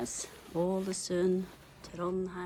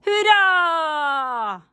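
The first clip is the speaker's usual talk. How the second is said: high loud drawn-out hurra; a big hurra for the dog; cheer; 11 semitones higher, 14 dB louder than usual. Real, not acted. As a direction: cheer the word out long.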